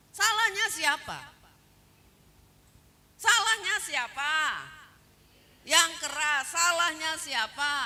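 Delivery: loud, insistent pulpit challenge; forceful preaching. Real, not acted. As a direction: press the congregation for an answer. Preacher challenges congregation for answer.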